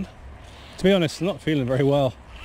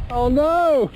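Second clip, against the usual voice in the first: high yelp of dismay as the hat goes; real startled oh no; sudden dismay; 9 semitones higher, 4 dB louder than usual. Real, not acted. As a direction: cry out as the wind takes it. Hat blown away by wind.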